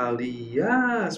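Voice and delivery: Aliás drawn out with "ás" held; Aliás sounded out to show the stressed ending; stress on the final syllable. Shown as a demonstration. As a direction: stretch the last syllable of Aliás. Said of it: "a sílaba tônica é essa".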